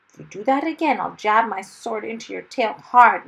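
fierce. A narrator's reading. Hero to dragon after being knocked flat, fierce. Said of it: "Mister Wumble said fiercely".

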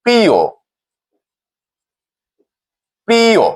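প্রিয় ending in a clear "o"; pronunciation demo. Shown as a demonstration. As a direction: say প্রিয় as "priyo", falling on the last vowel. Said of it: "নিয়ম অনুযায়ী উচ্চারণটি হবে".

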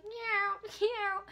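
high, loud imitation of a meowing kitty; cat meowing. Woman acting out her cat held by the neck, meowing. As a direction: yowl it like a protesting cat. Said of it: "And the kitty's like".

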